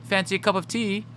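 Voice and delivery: put-on light British accent; mock British accent. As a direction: play a hammed-up Brit offering tea. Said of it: "That's British".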